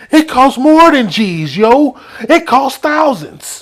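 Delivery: put-on slow, clueless teen insisting he's right; dumb New York kid. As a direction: play the clueless teammate, drawn out. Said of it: "he said".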